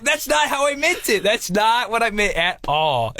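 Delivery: defensive protest. Retold or imitated. Husband explaining himself to angry wife, defensive.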